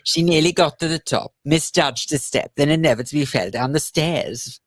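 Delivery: slow, singsong bad Welsh accent; attempted Welsh lilt. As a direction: recite verse in a shaky attempt at Welsh. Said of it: "Humpty Dumpty poem again with a Welsh lilt".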